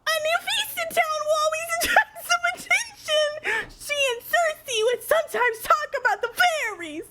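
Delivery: very high, airy character squeak; top-range airy voice. Shown as a demonstration. Streamer reading a character's lines, absurdly high airy voice. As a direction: hold the very top of your range, full of air. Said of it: "Top range of your voice and keep that tone with a ton of air".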